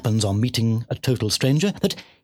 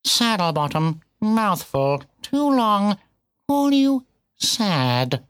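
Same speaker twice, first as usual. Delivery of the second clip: higher-pitched, terse cat voice; bitten-off words; 7 semitones higher, much slower than usual. A narrator's reading. Cat tersely answering the piglet, words bitten off. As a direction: bite off each short phrase. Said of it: "seemed to bite off its words and spit".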